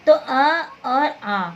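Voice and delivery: अ short and आ long, slowly contrasted; drawn-out vowels. Shown as a demonstration. Teacher slowly sounding अ and आ for the class. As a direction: stretch out अ then आ for the class. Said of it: "इसके लिए अ और इसके लिए आ बोलते हैं".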